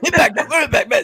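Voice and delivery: very high-pitched mock co-host voice; Trent. Podcaster proving he can do his co-host, squeaky impersonation. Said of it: "I could do it".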